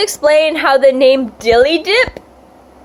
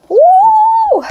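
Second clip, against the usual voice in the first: high tipsy party whoop; 4 dB louder than usual. Real, not acted. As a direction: let out a wild whoop. Drunk shout in shower.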